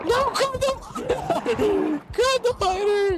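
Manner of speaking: high, slow laughing plea; panicking gamer. Real, not acted. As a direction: beg the game while laughing.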